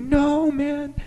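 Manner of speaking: high squealing protest; a falsetto mock whine of no; panicked plea.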